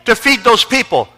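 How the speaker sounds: high, loud revival preacher; full-force preaching. Acted. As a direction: preach it loudly to a big congregation.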